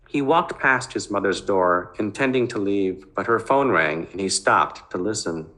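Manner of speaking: ordinary delivery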